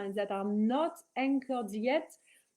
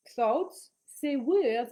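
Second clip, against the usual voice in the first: usual speaking voice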